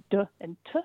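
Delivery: "d and t" said as short sounds "duh" and "tuh"; letter sounds. Shown as a demonstration. Linguist listing merged sound pairs, "d and t" voiced as "duh" and "tuh". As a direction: sound out "d and t", not the letter names. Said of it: "between the sounds".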